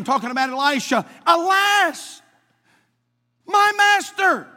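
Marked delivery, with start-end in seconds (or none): 1.3-4.5 high, loud anguished cry; passionate cry. Acted. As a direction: cry out in alarm to your master. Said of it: "there's an exclamation point right there".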